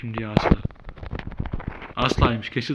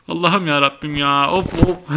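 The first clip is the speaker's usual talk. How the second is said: exasperated groan; 5 semitones higher, 6 dB louder, slower than usual. Real, not acted. Exasperated after the shock.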